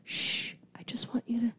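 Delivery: soft, earnest whisper; hushing whisper. Retold or imitated. Boyfriend about to propose, whispering to calm her. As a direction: hush them gently, all breath.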